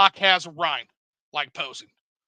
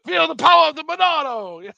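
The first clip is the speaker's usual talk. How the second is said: much higher, louder hammed-up hero cry; heroic game quote; 10 semitones higher, 6 dB louder than usual. Echoing his friend's game quote, hammed-up heroic cry. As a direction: overdo the hero's battle shout.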